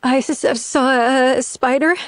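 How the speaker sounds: high, hesitant stammer; stuttering. Acted. Woman making up an excuse for Jack, stuttering. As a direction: stumble on the first sounds while inventing an excuse. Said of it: "stuttering as she attempts to come up".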